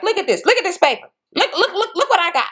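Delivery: high, fast, half-laughing mock rant; mock fuming. Mom waving the restraining order at her daughter, high fast mock fuming. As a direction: rattle it off like an outraged mom waving a paper. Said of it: "mama is in the house fuming".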